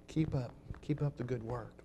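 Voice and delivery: soft, low encouraging aside; quiet encouragement. Acted. People standing round encouraging a church member, quietly. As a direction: say it softly, as if in passing.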